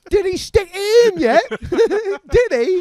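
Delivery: high, loud mum voice through laughter; prying mum, hammed up; mom's voice. Mum prying about the hookup, high and loud.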